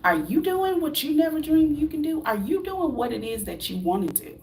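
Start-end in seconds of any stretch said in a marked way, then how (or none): none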